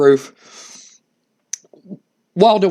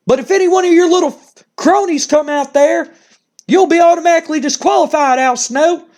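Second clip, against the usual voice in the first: much higher, louder laughing sneer; Jim Cornette taunting; 13 semitones higher, 3 dB louder than usual. Retold or imitated. Manager mocking Al Snow and setting match terms, high smug voice. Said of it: "He's like".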